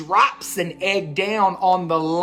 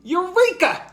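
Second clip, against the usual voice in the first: high, louder eureka squeak; excited squeak; 12 semitones higher, 4 dB louder than usual. A narrator's reading. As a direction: burst out with the discovery. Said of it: "squeaks Marvin".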